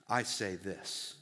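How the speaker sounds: low, slow, authoritative pronouncement; measured heavenly authority; Jesus. Acted. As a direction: drop deep and slow to lay down the final word. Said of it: "Jesus is speaking with heavenly authority".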